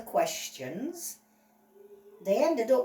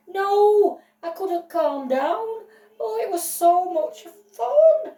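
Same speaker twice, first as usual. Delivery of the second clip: giddy excitement; 9 semitones higher, 7 dB louder than usual. Acted. Dog witness excited on the stand.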